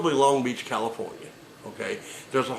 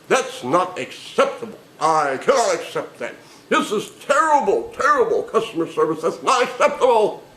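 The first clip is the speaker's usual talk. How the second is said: building from indignant to shrill, louder outrage; escalating mock outrage; 6 semitones higher, 5 dB louder than usual. Entitled caller ranting at the agent, building to a yell. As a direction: work yourself up into customer fury.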